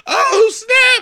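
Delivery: very high squealed exclamation; mock-hysterical high wail; hyped-up reaction. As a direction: shriek it like a hype man. Excited reaction to Rick's rap line.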